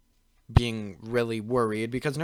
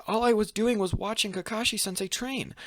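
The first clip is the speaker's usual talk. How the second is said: higher-pitched retold protest; young Naruto's reply; 10 semitones higher than usual. Naruto explaining his eyes to Hiruzen, higher-pitched reply. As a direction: answer in a raised, innocent-sounding pitch. Naruto answers the Hokage's question.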